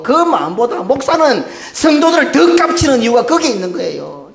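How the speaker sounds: high, loud preaching at full force, half laughing; impassioned preaching. Real, not acted. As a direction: pour out a pastor's heart, loud and high. 설교 절정, 힘주어 외침. (높고 크게 열정적으로 외치는 설교)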